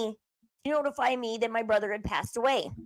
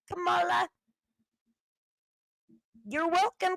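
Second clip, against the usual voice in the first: higher sing-song taunt, calling out her name; sarcastic farewell to Kamala, pitched up; 4 semitones higher than usual. Pretend. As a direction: hand them over with mock generosity.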